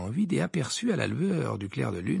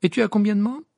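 higher, louder sceptical question; Ron; 9 semitones higher, 6 dB louder, faster than usual. A narrator's reading. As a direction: ask with a hint of doubt. Voices Ron questioning Hermione. (voix de Ron, sceptique)

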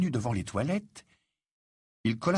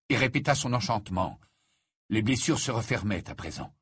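usual speaking voice

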